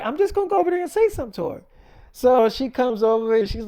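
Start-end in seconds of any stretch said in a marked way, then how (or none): 0.0-1.6 quick, high-pitched insistence; insistent retort. Acted. Friend insists on going over to her, quick high retort. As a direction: rush it out high, brushing off the objection.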